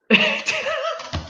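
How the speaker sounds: high helpless giggle; a burst of giggles you can't hold in; real giggling fit. Real, not acted. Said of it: "I get the giggles and I can't keep a straight face".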